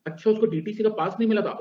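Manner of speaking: mock surprise. Student mocking teacher's answer.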